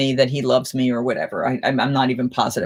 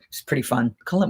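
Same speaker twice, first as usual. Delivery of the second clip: ordinary delivery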